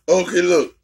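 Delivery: normal talking voice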